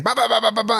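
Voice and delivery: high, driving "ba-ba" riff imitation; imitating fast riff. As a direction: mimic the band kicking back in. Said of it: "then they go back and".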